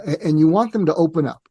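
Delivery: ordinary delivery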